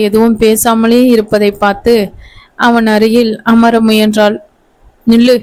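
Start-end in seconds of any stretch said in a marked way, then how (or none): 5.1-5.4 a stern "stop" with a little more force; husband barks a short warning at his bride; mild harsh command. A narrator's reading. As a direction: order her to halt, firmly but held back. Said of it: "கடுமையான குரலில் கூறினான்".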